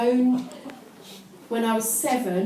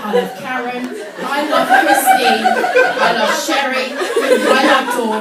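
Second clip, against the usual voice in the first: louder, laughing through the list; can barely read for laughing; laughing while reading; 3 semitones higher, 12 dB louder than usual. Real, not acted. Cracking up reading own diary.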